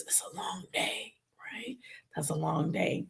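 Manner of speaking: soft whisper to viewers; whispered aside. Real, not acted. Telling viewers about long Sundays, whispered. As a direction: breathe it out, confiding. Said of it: "whispered aside".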